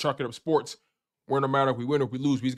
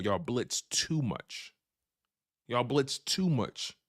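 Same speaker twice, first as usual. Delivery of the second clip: slow, weighty, "too" leaned on; slow emphatic jab; 6 dB quieter, much slower than usual. Real, not acted. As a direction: drag out "too fucking much". Repeating the jab slowly.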